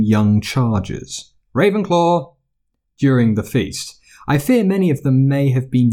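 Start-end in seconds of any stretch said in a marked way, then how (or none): 1.6-2.3 high, ringing announcement of the house; Sorting Hat call. A narrator's reading. The hat calling out the new student's house.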